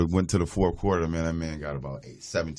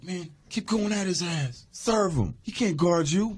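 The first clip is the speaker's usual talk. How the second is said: hyped teammate; 11 semitones higher, slower than usual. Retold or imitated. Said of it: "K G like".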